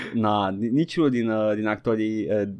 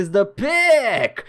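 excited; 9 semitones higher, 3 dB louder, much slower than usual. Real, not acted.

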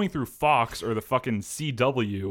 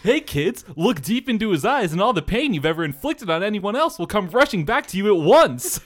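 toy commercial announcer; 7 semitones higher, 6 dB louder than usual. Pretend. Mock toy commercial pitch.

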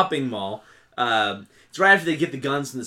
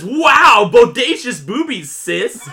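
loud, high hammed-up exclamation; big whooping "wow", easing off into "sis"; mock amazed outburst; 6 semitones higher, 8 dB louder, slower than usual. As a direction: burst out like an awestruck peeping brother. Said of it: "he pops out and he says".

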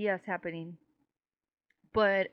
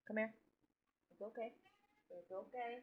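soothing cat; 16 dB quieter than usual. Real, not acted.

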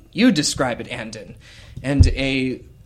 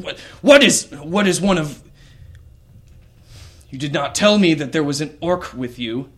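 shouted high and loud; angry outburst; 3 semitones higher, 4 dB louder than usual. Acted. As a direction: explode at the betrayal. Host furious at orc guest.

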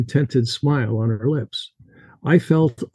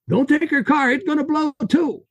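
put-on high, urgent Russian accent warning; 13 semitones higher than usual. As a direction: warn him fast in a thick Russian accent. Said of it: "His Russian accent heavy".

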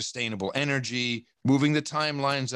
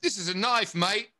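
higher-pitched mock Aussie accent; Australian accent; 8 semitones higher than usual. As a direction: put on a playful Australian twang. Acted Australian film catchphrase.

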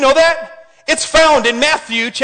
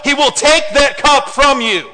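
ordinary talk, nothing marked

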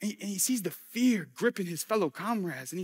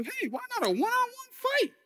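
very high, softer mock voice; comic squeaky proposal; 5 dB quieter than usual. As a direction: pitch it way up for a joke suggestion.

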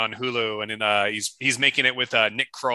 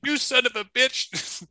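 put-on old man caught out; 10 semitones higher than usual. Old man iced in skit.

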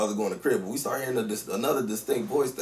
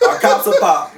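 louder and higher through laughter; laughing repeat; 9 semitones higher, 13 dB louder than usual. Shown as a demonstration. Host repeating his own slip while laughing. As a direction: say "Our cops are pop" again, cracking up at the slip. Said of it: "That's the name of the fucking podcast".

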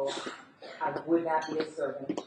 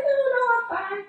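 much higher, quick little brush-off; polite refusal; 4 dB louder, much faster than usual. Retold or imitated. Guest quickly refuses the host's water, high and fast. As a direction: wave off the offer fast and bright.

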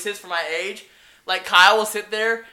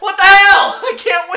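high, loud, laughing outrage; squawking comic protest; exasperated yell; 13 semitones higher, 10 dB louder than usual. Retold or imitated. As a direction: shout it in mock despair. Exasperated retort to being mimicked.

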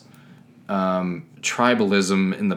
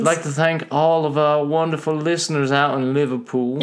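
a bad put-on Liverpool British accent, pitched higher; terrible British accent; 7 semitones higher than usual. Cohost hamming a British Beatles thank-you in a bad British accent. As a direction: ham up a fake British accent, higher than usual. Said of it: "That was a terrible British accent".

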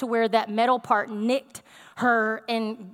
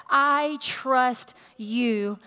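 drawn out word by word; deliberate, half-speed declaration of trust; slow, deliberate; much slower than usual. Acted. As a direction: declare it very slowly, weighing each word.

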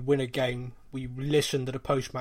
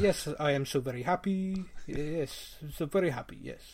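put-on lightly done Argentine-accented manager; a hint of Pochettino's accent; Pochettino impression; 5 dB quieter than usual. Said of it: "I'll do my best impression".

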